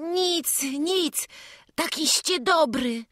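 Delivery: put-on high, loud small boy through tears; a little boy fighting back sobs; tearful boy Wawrzuś. A narrator's reading. Chłopiec przez łzy odpowiada królewiczowi, wysokim płaczliwym głosem.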